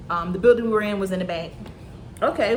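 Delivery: normal talking voice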